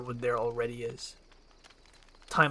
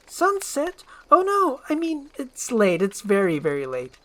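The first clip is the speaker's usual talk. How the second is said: high, loud fluster easing into excuses; startled, scrambling for an excuse; alarmed princess; 10 semitones higher, 5 dB louder than usual. Acted. Startled by sunset, makes excuses.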